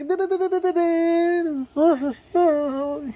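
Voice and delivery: high, rapid da-da-da noise; long high stuttered imitation; imitated rattling noise. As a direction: rattle off a high-pitched mock sound effect. Imitating the badass character's noise.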